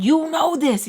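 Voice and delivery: high, loud acted exasperation; exasperated retort. Reminding him she has no money, exasperated.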